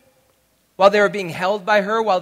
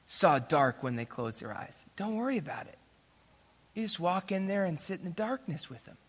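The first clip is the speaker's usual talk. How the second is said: soft, hushed retold advice; gentle reassurance; 10 dB quieter than usual. Friend advises father to sit with son, gentle reassurance. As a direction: quietly ease a worried father.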